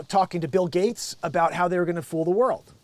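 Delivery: ordinary talk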